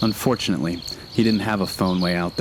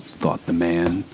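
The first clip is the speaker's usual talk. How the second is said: ordinary delivery, nothing marked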